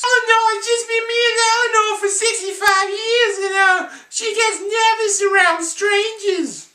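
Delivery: put-on very high, loud, sing-song little old man voice; Whitey impression. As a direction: squeal it like a fussy tiny old man, slowing at the end. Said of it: "are all these impressions good or bad".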